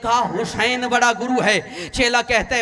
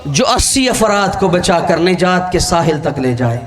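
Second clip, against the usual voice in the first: full-force preaching; 4 semitones lower than usual. Acted. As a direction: declaim it to the whole gathering, building to the name. Preacher addresses majlis crowd.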